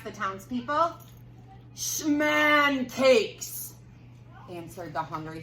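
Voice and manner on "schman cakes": monster voice. Acted. Hungry thing answering the townspeople, loud creature voice. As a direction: boom the word like a hungry beast.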